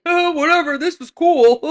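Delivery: mother mimicking the reckless driver, mocking; mocking careless kid. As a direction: ham up a kid shrugging it off with a laugh. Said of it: "he was laughing and very".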